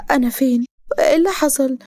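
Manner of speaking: lightly voiced confused heroine; dazed heroine. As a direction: ask as if just coming round. Heroine waking in hospital.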